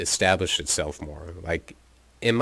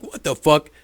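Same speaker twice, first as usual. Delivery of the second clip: startled; 9 semitones higher, 7 dB louder than usual. Retold or imitated. Schmokes jolted awake, startled.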